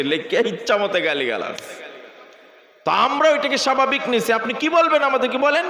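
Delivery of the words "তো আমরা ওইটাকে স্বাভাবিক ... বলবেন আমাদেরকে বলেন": higher, louder, shouted preaching; full-force sermon. Acted. Preacher shouts defiance at critics of the clergy, full force. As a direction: thunder it from the pulpit.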